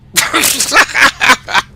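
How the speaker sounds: loud, high burst of laughter. Real, not acted. Streamer amused by his own Southern accent, loud high laugh. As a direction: crack up loudly at yourself.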